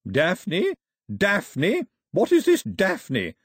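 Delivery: high, rising scornful repeats; imperious disbelief. Acted. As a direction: query the name with mounting haughty outrage. Grandmother scorns the girl's chosen name.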